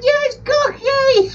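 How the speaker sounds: very high, drawn-out squeal; squeaky parrot talk. Retold or imitated. Telling the post lady about Cocky, high squealed retelling. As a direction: squeal it slowly in falsetto, breaking into laughter.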